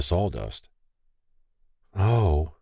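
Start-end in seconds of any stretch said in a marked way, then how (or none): none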